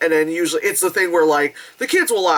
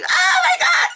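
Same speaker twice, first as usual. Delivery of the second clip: shrieking high excitement; squealing kids; kids cackling. Retold or imitated. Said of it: "these kids were, like, cackling".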